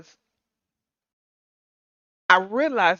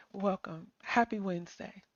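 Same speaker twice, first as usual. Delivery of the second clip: whispered welcome; 3 semitones lower, 11 dB quieter than usual. Real, not acted. Greeting a new listener.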